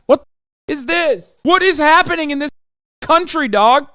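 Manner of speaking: loud, high-pitched bewilderment; incredulous exclamation. Real, not acted. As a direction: cry out in exasperated disbelief. Exclaims at absurd British police response.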